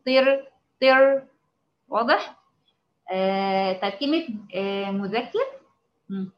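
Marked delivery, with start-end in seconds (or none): 0.1-1.3 طير drawn out slow and louder with a full emphatic ṭa; teacher drilling طير so students hear the heavy ṭa; heavy demo. Shown as a demonstration. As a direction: model طير slowly, pressing the emphatic ṭa. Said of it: "خدي بالك هي مطبقة برضو".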